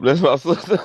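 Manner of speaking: laughing. Real, not acted. Laughing at friend.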